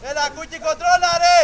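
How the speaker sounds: loud instruction to a player; urging shout. Real, not acted. Coach urging Κούκι to control it, loud shout. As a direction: shout it across the pitch.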